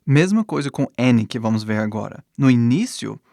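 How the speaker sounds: ordinary delivery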